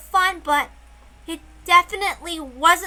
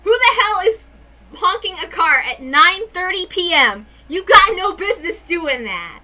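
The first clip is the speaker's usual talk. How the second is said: louder, irritated griping at the noise; real annoyed rant; 4 semitones higher, 9 dB louder than usual. Real, not acted. Narrator vents at a honking car outside, irritated and loud. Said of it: "I'm so fucking pissed".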